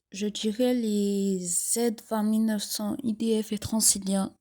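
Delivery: ordinary talk, nothing marked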